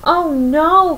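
high, loud panicked cry; cried out. A narrator's reading. Pleakley seeing Stitch escape, high alarmed cry. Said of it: "cried Pleakley".

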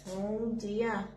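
normal talking voice

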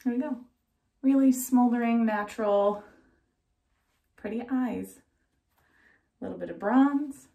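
muffled by cloth mask; speaking behind mask; through a face mask. Real, not acted. Seen 0: Pleated cloth mask covers mouth and nose throughout labelled words.

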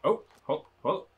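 ordinary delivery

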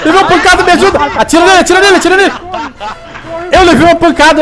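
high panicked yells for help; hit hard crying out. Real, not acted. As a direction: cry out, hurt and desperate. Hit in game, begging for help.

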